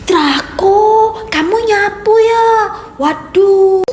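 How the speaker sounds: overwhelmed by disbelief; astonished. Acted. Mother stunned her son is sweeping, loud and high.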